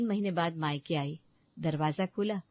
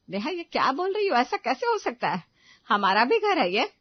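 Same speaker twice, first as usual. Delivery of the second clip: higher, louder shocked mother; hurt protest; 7 semitones higher, 8 dB louder than usual. Acted. Mother-in-law to daughter-in-law, shocked and hurt. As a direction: plead in disbelief.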